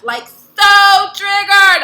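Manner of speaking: high, loud, slow stretch on so; so triggered stressed. Real, not acted. Telling viewers how rattled a quick hang-up left her, with a slow, high, loud so. Admitting how upset she was.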